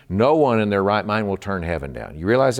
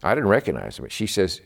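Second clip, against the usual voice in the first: ordinary talk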